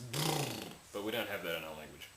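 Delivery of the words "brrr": brrr as a sustained buzzing trill; funny trill. Shown as a demonstration. Showing what the capital B represents, trilled brrr. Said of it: "And this represents".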